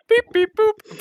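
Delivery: high, laughing robot beep-boops; droid beeps. Retold or imitated. R2 droid beeping, imitated.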